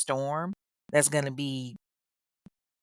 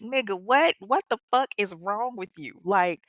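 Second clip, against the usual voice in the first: incredulous David; 4 semitones higher than usual. Retold or imitated.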